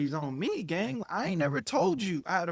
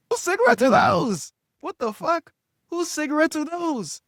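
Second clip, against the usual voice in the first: loud, high incredulous shout; shocked outburst; 11 semitones higher, 8 dB louder than usual. Retold or imitated. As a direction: re-enact a yelped, baffled demand. Retells shock at finding cigarettes.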